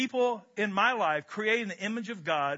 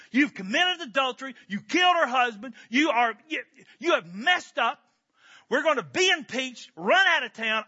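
loud, higher, scolding accusation; harsh confrontation; 4 semitones higher, 4 dB louder than usual. Retold or imitated. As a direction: tear into him like an outraged advisor. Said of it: "If you confront people that way".

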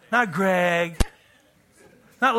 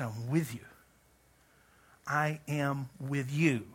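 slow, soft, low "I am with you"; tender reassurance; 5 semitones lower, 8 dB quieter, slower than usual. Shown as a demonstration. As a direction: promise it gently and slowly.

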